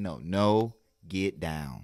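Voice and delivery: loud, firm command in a full chest voice; bass in the voice. Shown as a demonstration. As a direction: order the pet down with weight and authority. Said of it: "have to have bass in your voice".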